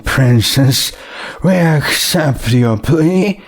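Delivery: a judge addressing the accused from the bench; stern judge. Acted. Judge voice held in court.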